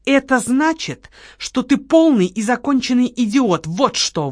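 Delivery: angry retort to her partner; blurted out angrily. Acted. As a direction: fling the insult at him. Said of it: "выпалила Лола".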